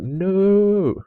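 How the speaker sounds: a high, sudden cry of "No!"; anguished cry. Retold or imitated. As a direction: yelp at the screen as the ball goes in. Despair watching opponent's shot.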